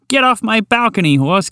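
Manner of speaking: put-on high, scolding old lady shooing a horse; angry old woman. Old woman orders the horse off her balcony, high and cross. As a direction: shoo the horse off like a furious granny. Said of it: "shake it around threateningly".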